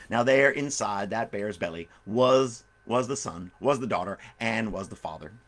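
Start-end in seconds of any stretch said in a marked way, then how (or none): none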